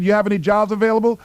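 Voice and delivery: high, fast hopeful question; a persistent young job seeker; eager asking. Retold or imitated. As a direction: rush the ask out brightly. Eager applicant keeps asking.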